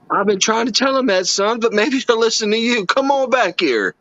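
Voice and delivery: imitating Carroll Shelby. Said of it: "He goes".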